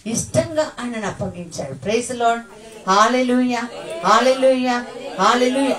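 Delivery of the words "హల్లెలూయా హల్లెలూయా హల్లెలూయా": loud rising hallelujah calls; called hallelujah. Real, not acted. Preacher rousing the church, loud calls. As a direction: rouse the congregation with loud cries.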